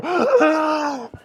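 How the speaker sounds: a high, hammed-up gasp of horror; mock gasp. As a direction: gasp as if heartbroken, half-joking. Reacting to art washing away.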